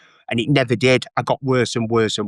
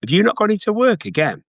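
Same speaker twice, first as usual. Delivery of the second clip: mum scolding; 7 semitones higher than usual. Retold or imitated. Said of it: "my mum would come in my room and she'd go".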